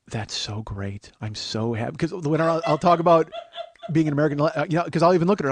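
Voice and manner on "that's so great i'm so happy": drawn-out, quiet, deadpan praise; slow deadpan sarcasm. Retold or imitated. Wife teasing husband about his gold card, slow deadpan. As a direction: humor him slowly with dry fake delight. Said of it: "she just goes".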